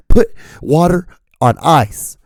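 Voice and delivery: high, cracking squeal of outrage; indignant high-pitched rant; upset screaming rant. Real, not acted. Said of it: "watching [name] being so upset and really screaming".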